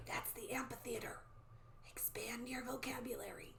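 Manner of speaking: hushed whisper to listeners; soft, breath-only side note; whispered aside. Real, not acted. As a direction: whisper a teacherly tip.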